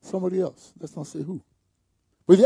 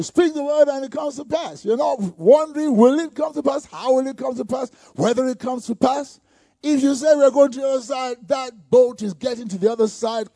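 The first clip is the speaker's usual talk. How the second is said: preach; 7 semitones higher, 6 dB louder than usual. Acted. Preaching at full force.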